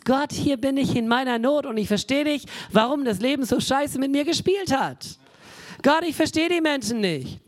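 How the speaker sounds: honest lament. Retold or imitated.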